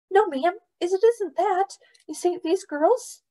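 put-on very high, pleading boy's voice; protesting boy. A narrator's reading. Boy explains himself to angry mother, high and pleading. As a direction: plead your case to a cross adult.

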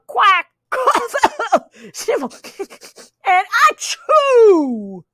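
high quack broken by laughs; laughing quacking dog. Acted. Reader laughs while the dog quacks and sneezes. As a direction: laugh through the dog's sneeze. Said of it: "said the dog".

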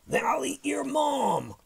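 high, loud roar of a threatening monster; roaring dinosaur. A narrator's reading. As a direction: bellow the threat like a storybook dinosaur. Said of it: "roared the super hungry dinosaur".

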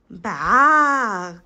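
loud, drawn-out baa; sheep's bleat. A narrator's reading. Narrator voices the sheep behind the gate, bleating.

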